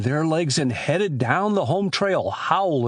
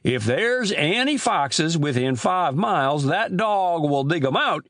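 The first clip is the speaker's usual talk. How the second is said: Amos Grice; 4 semitones higher than usual. Acted. Old storekeeper telling boys of lost chickens, high drawling voice.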